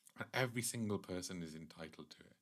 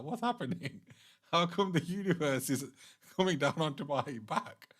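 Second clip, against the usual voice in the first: higher, startled mock protest; bewildered tortoise; 6 semitones higher, 4 dB louder than usual. Storyteller voices Kurmadev as the universe presses on his shell, mock bewilderment. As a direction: act out a puzzled tortoise under sudden weight.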